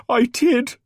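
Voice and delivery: high, loud sobbed reply; tearful cry; sobbing. A narrator's reading. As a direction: choke out the answer. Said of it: "Francine sobbed".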